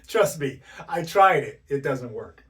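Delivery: rueful exclamation. Real, not acted. Laughing admission after laugh.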